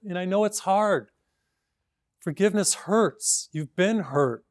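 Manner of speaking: ordinary delivery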